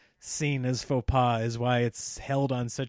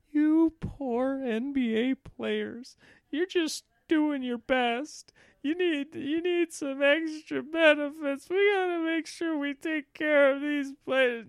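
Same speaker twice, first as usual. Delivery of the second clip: mock pity; 11 semitones higher, slower than usual. Mocking coddlers of NBA players.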